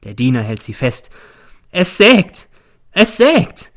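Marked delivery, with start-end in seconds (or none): 1.7-3.7 high, loud, frantic wail. A narrator's reading. The mother in a fit before the court, wailing high and loud. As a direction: cry it out like a haunted woman. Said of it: "jammert sie".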